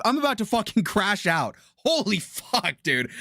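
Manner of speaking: normal talking voice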